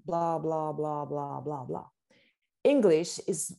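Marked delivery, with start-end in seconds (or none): 0.1-1.8 "blah, blah" evenly spaced on a level tune, sinking lower; monotone French rhythm. Shown as a demonstration. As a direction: drone each "blah" at the same beat, getting softer. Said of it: "French is, is very, like".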